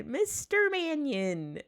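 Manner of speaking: high, long-drawn grandiose name call; Mister Nimbus. Acted. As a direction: draw it out high and slow, in character. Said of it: "I say it in the Mister Ni".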